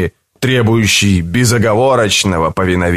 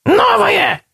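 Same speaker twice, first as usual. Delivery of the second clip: very high shrill creature shout; whistling creature. A narrator's reading. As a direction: squeal it like a greedy beast.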